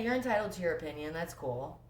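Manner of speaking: ordinary talk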